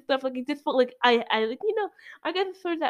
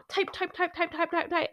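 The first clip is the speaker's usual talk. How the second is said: mock typing; 7 semitones higher, 5 dB quieter than usual. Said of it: "they just, like".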